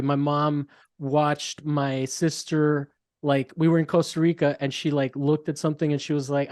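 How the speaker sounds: ordinary talk, nothing marked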